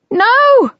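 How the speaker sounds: high, loud yell. A narrator's reading. Lady yelling at her brother outside, loud. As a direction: shout it through the door. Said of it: "she yelled".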